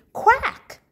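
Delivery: high, loud quack; duck quack. Acted. Teacher reading the duck's quack, high and loud. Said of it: "the duck moans".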